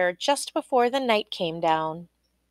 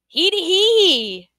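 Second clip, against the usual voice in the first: long, high, loud "hee-hee" laugh; high gleeful cackle; 10 semitones higher, 5 dB louder, slower than usual. A narrator's reading. Little man laughing at the queen's failure, cackling. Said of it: "He doubled over laughing".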